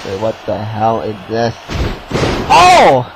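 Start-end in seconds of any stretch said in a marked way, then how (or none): 2.5-3.0 high, loud groaning complaint; exasperated outburst. Real, not acted. Commentator cursing the ref mid-match, exasperated outburst. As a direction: burst out at a blundering referee.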